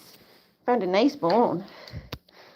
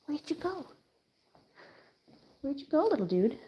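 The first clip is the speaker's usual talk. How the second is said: soft pet talk; 6 semitones higher, 10 dB quieter than usual. Real, not acted. Talking to the dog.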